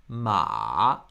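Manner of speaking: ma dips low then rises; third tone. Shown as a demonstration. Teacher gives ma the third tone, dipping. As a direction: let ma sink low and come back up.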